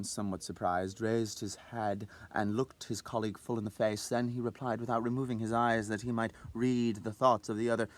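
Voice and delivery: plain reading voice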